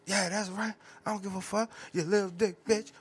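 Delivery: put-on loud taunting girlfriend voice; mocking girlfriend. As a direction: sneer it like a mad ex. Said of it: "her favorite thing is to come out and say".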